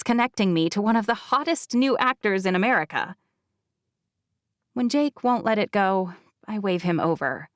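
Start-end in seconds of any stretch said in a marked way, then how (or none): none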